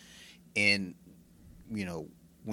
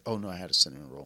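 ordinary delivery

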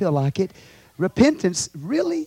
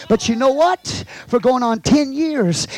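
ordinary talk, nothing marked

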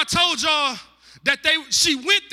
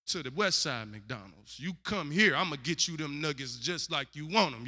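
much softer, lower, half to himself; low quiet playful aside; 8 semitones lower, 9 dB quieter than usual. Retold or imitated. Preacher acting Boaz wooing Ruth, low and hushed. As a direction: tell it low and hushed like a joke to the crowd. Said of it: "he was like".

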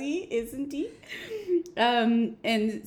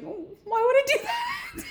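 high, drawn-out comic whine; mock wounded protest; slower than usual. Sam refusing to insult Dean back, mock wounded. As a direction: act baffled and hurt, laughter close by.

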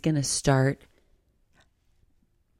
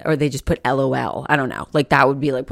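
ordinary talk, nothing marked

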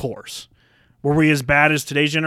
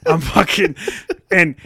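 ordinary talk

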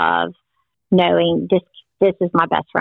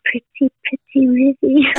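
imitating the toddler; 7 semitones higher than usual. Granddaughter repeating the birds' song to her mother, small-child voice.

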